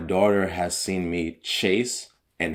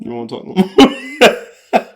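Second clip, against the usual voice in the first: laughing; 13 semitones higher, 11 dB louder than usual. Real, not acted.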